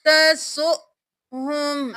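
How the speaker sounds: तसुहुम slow, with a catch on the hamza; Quranic recitation. Shown as a demonstration. Teacher demonstrating the stop, recited slowly. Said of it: "हा पेश मीम हुम".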